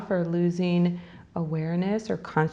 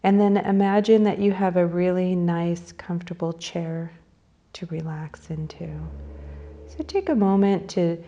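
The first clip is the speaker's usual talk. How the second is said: unhurried phrases, leaving space to breathe; guided meditation. Real, not acted. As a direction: lead them gently into breathing and imagery.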